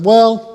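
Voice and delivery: a loud, high stalling well; mock-pompous loud well; drawn-out well. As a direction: stall loudly before the dull answer. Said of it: "I'm gonna kill this conversation".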